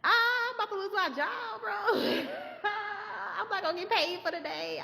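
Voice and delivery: high-pitched hammed-up wail; whiny fake sobbing, half laughing; mock crying. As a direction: wail in comic despair about being fired. Mock wailing over losing job.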